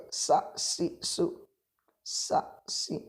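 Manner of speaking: sa si su breathed out soft with almost no voice; airy sin syllables. Shown as a demonstration. Teacher drills the small sin for students, soft and airy. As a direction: hiss each sin syllable lightly on the breath. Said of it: "Di sini sin kecil".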